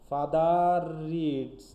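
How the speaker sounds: father reads drawn out very slowly, the r of father running straight into reads; very slow teaching demo of father reads with a linking r; linked r demo. Shown as a demonstration. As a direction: stretch father reads out slowly so the r links into the next word. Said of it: "একসাথে হয়ে যাচ্ছে".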